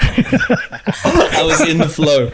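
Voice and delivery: slow, high, laughing excuse. Pretend. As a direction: stretch it out while laughing. Jokes about lateness excuse.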